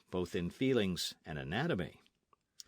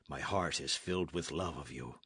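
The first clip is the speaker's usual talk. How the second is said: soft whisper, softer and lower; whispered love; 7 semitones lower, 6 dB quieter than usual. Acted. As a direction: breathe the confession to her.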